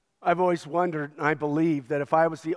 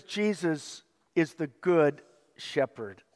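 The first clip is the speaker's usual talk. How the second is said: slow down on Jesus is the good shepherd, weighing each word; slow, deliberate reading; 3 dB quieter than usual. Shown as a demonstration. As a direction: read it out slowly, word by word. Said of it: "I want you to see this".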